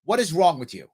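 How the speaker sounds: ordinary talk, nothing marked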